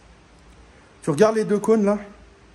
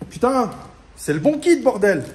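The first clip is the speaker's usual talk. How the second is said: real frustrated swearing, louder; cursing the mismatched kit, fed up; angry outburst; 5 dB louder than usual. Real, not acted. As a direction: vent at the useless parts. Mismatched parts in right kit.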